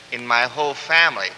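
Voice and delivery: usual speaking voice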